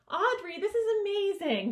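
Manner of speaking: delighted praise. Acted. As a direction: gush like she's just been saved. Librarian praising Audrey's fix.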